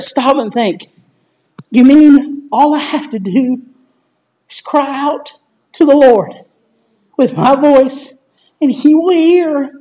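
soft, long pauses between phrases; pausing to think. Shown as a demonstration. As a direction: stop and let each phrase sink in. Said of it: "There's that think and pause about it".

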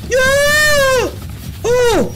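loud high startled shout; startled yell. Real, not acted. Watching ghost footage, sudden loud yelp. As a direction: yelp at the shock.

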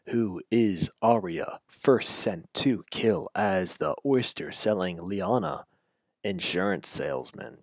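slow, drawn-out reading; slow drawl. Acted. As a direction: stretch every word slowly. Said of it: "just talk normally me".

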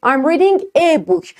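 I'm reading a book, slow, reading stressed; slow model sentence. Shown as a demonstration. Teacher slowly models the sentence for students. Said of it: "بعد بگی".

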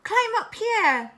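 high, loud, drawn-out call; monkey calling out. A narrator's reading. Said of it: "called the monkey from the treetops".

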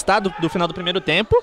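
ordinary delivery

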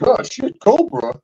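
high startled yelp into laughing; mock fright at a cobra; mock panic. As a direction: jump at the snake. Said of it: "everybody's like".

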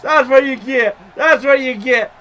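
high, loud taunt; gloating shout. Real, not acted. Gamer gloating at opponent he hit, high gloating shout. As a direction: crow it at a beaten rival.

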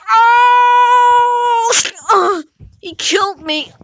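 dying cry. Acted. Dick dying.

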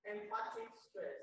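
"Emphatic stress" dicho mucho más agudo, sin subir el volumen; term repeated high. Shown as a demonstration. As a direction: repite "Emphatic stress" con la voz mucho más aguda. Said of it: "today we are going to treat".

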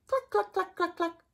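high clucking hen sound. Shown as a demonstration. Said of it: "What does a hen say?".

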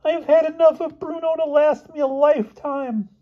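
very high, squeaky complaint; fed-up Elmer. Acted. Boy complaining about his roommate, high squeaky exasperation.

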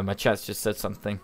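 usual speaking voice

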